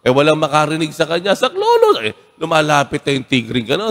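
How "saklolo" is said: high panicked cry for help; Tagalog: sigaw ng takot; screaming for help. A narrator's reading. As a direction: shriek it out in terror, much higher. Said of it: "Nagsisigaw siya".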